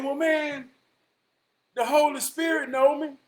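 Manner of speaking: high loud shout. Retold or imitated. The Pope shouting his last appeal at heaven's gate.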